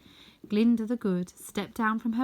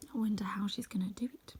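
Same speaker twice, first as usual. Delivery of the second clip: softer, whispered aside to the listener; 6 dB quieter than usual. Real, not acted. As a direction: whisper it, as if to yourself.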